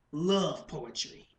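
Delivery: slow, measured punchline; restrained poetic punchline, no shouting; spoken-word performance. Acted. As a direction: let it drop slowly and deliberately. First take of the poem's hook.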